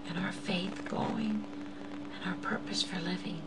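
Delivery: hushed whispered plea. Real, not acted. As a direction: whisper it like a secret hope. Intimate appeal, unvoiced.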